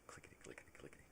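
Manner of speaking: whispered key patter; hushed backspacing noise; imitated typewriter clicks. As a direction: rattle it off softly, without voice. Imitates typewriter going back.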